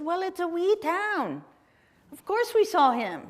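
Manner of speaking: high, rough imitation of a Scottish local; attempted Scottish accent. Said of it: "in a very thick accent".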